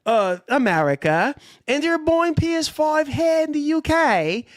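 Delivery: lightly put-on British accent, cheering PS5 sales. Co-host reads PS5 news in a lightly mocking British accent. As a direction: hams up a British lad gloating over sales. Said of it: "[name] is sounding like he's mocking us U K lads".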